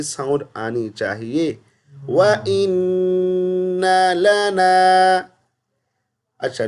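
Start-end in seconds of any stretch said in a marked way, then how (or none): 2.1-5.2 وَإِنَّ لَنَا slow and melodic, with a short noon; correct recitation. Shown as a demonstration. Teacher recites the correct وَإِنَّ لَنَا slowly.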